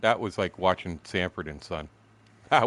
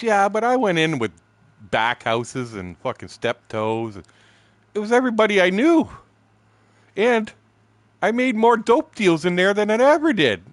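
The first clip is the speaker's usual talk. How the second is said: high, loud, gleeful boast; excited bragging; 12 semitones higher, 8 dB louder than usual. Retold or imitated. Kerry defending his two weeks in jail, loud excited brag. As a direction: act out a friend crowing loudly.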